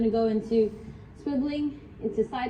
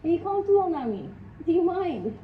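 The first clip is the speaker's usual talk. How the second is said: pet talk; 6 semitones higher than usual. Real, not acted. Talking to the dog mid-plank.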